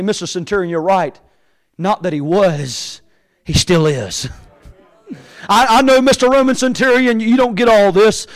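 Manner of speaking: usual speaking voice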